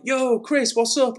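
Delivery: high, hyped-up hello to a mate; mock chat-regular's excited hello; imitated greeting. Acting out viewers greeting each other.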